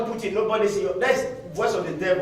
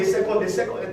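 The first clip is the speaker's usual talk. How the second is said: ordinary delivery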